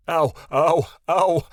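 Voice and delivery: loud, rising yelps of pain; screeched. A narrator's reading. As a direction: yelp as hair is pulled. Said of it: "Kasif screeched".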